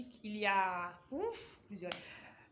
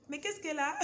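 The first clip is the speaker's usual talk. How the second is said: high, louder, laughing through the words; amused self-mocking laugh; laughing at herself; 10 semitones higher, 6 dB louder than usual. Real, not acted. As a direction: tease yourself for fumbling a word. Mocks her own stumble, laughing.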